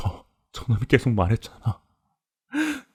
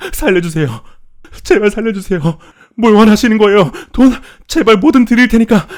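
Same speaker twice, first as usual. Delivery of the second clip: high, loud terrified pleading; desperate begging; 12 dB louder than usual. Acted. Captive begging the farmer to spare him, desperate screaming. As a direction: scream for your life, building louder. Bound captive begs for his life.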